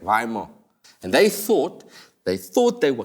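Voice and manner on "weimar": Weimar as VYE-mar, low and unsure; trying Weimar. Shown as a demonstration. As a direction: test the German way Weimar. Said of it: "Is that how you say it?".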